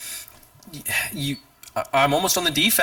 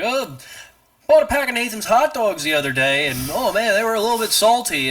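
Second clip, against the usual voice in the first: mock-chatty, higher-pitched rambling; oblivious small-talker; 8 semitones higher than usual. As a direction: put on a high, silly small-talk voice. Mimics someone making dumb small talk.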